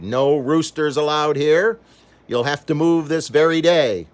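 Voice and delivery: angry landlady. Acted. Landlady evicting Mister Piper, loud angry scolding. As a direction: order him out in anger. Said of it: "She was very angry".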